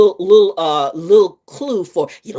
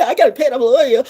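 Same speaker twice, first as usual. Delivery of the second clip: higher, louder mock dog voice; proud loyal dog; 7 semitones higher, 4 dB louder than usual. Streamer mocking pets, hammed-up proud dog. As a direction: play the dog, boasting loyalty.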